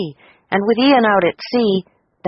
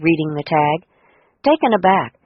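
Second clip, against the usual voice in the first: ordinary delivery, nothing marked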